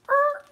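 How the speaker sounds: high loud screech of brakes; braking screech. Acted. Teacher voicing the coaster stopping, a high loud screech. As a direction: screech the ride to a stop. Ride slams to a stop.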